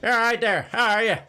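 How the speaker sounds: loud, high, harsh Northern Irish voice; hammed-up Northern Irish drunk. Drunk butch lady greets a stranger, harsh Northern Irish accent. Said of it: "talking like that with a real harsh voice".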